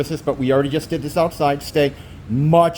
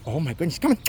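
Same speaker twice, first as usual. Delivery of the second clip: ordinary talk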